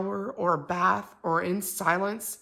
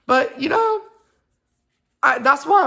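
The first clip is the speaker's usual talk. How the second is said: voice breaking; 7 semitones higher, 8 dB louder than usual. Real, not acted. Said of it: "I started crying again".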